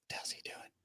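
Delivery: hushed whisper; whispered gossip. Retold or imitated. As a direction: whisper like nosy townsfolk. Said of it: "everybody's like".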